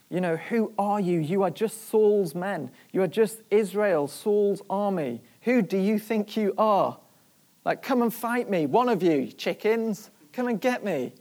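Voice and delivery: acted taunting shout. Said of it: "he's taunting them".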